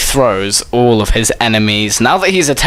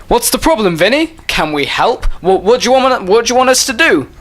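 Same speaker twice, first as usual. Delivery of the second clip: high-pitched chorus of concerned pals; eager helpful friends; 8 semitones higher, faster than usual. Acted. Friends offer Vinny their help, high and eager.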